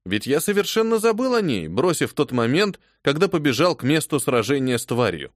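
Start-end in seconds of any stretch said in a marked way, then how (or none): none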